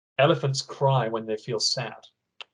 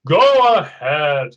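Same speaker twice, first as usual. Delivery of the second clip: put-on old elephant voice; 9 dB louder, much slower than usual. Bernard speaks in story.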